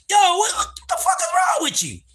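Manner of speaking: high shocked yell at her. Retold or imitated. Yelling at his girl who fell asleep sucking lipstick, shocked shout. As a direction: jolt her awake with a yell.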